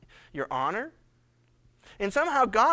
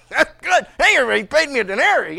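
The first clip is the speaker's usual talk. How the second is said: higher, louder, fast and pleased; louder gleeful brag; excited gloating; 5 semitones higher, 13 dB louder, faster than usual. Acted. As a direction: crow about the easy payday. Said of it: "and he goes".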